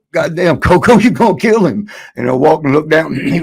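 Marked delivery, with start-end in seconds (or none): 0.1-1.9 higher, louder laughing alarm; shocked yell. Retold or imitated. Friends react to Coco kicking guy downstairs, shocked laughing yell.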